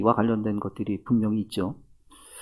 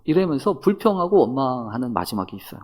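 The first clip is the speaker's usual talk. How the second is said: ordinary talk, nothing marked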